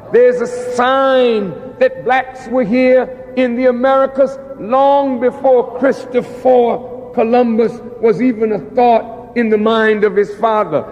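usual speaking voice

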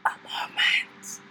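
slow exaggerated stage whisper; loud whisper. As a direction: whisper it back, drawn out. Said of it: "I'm gonna very loudly whisper".

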